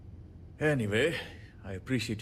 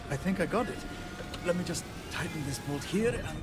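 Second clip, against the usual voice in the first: sudden bright rise on got; pleased it finally works; excited realization; 9 semitones higher than usual. Real, not acted. Fixing the machine, triumphant when it clicks. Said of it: "Wait. Hold on".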